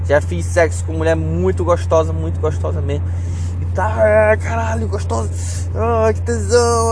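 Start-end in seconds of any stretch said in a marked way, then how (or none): 3.6-6.9 aroused moan. Retold or imitated. Acting out sex climax moaning.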